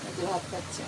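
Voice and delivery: softly, so as not to disturb the eating pet; hushed aside. Real, not acted. Telling viewers quietly how the pet eats, hushed. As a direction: drop your voice to show the viewers.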